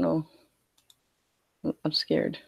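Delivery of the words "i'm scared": mock deep scared. Mock fear during losing match.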